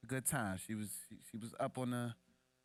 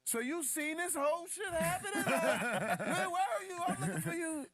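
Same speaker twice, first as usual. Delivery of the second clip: much higher, laughing disbelief at mom; incredulous self; 4 dB louder than usual. Retold or imitated. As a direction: retell it cracking up. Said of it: "I'm like".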